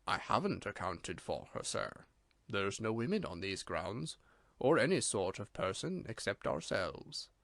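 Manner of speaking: a grave butler, quiet and correct, lightly voiced; Bates, solemn servant. A narrator's reading. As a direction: deferential servant murmuring low and solemn. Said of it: "liquid tones of Bates".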